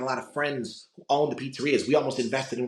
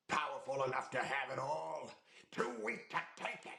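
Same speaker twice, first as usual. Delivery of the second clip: hushed, menacing villain impression; soft, rising into a strained near-whisper; villain movie line; 3 semitones higher, 12 dB quieter than usual. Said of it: "my favorite line in that".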